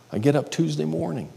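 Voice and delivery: ordinary talk, nothing marked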